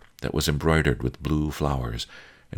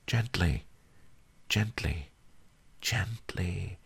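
softer each time down to a whisper; fading soothing; 5 semitones higher, 7 dB quieter, slower than usual. A narrator's reading. Master soothes pupil again, fading to whisper. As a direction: coax gently until it is only breath.

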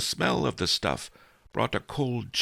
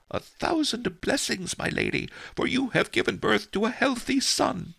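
midwife. Acted. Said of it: "she said".

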